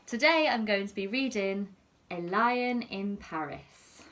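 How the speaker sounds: ordinary delivery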